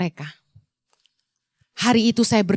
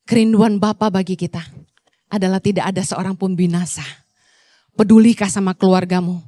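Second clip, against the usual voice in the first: usual speaking voice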